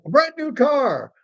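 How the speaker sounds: hammed-up game show announcer; US game show host. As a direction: hype it like a prize reveal. Mock US game show excitement.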